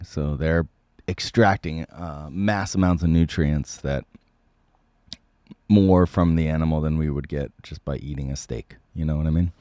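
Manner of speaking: ordinary talk, nothing marked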